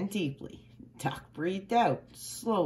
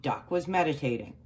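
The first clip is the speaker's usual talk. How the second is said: ordinary delivery, nothing marked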